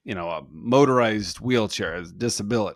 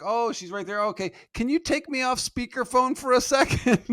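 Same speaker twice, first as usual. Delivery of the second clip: a lightly voiced wife, much higher; wife on the phone, high and brisk; imitating wife; 11 semitones higher than usual. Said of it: "my wife was like".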